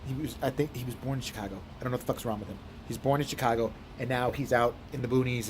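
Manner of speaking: ordinary delivery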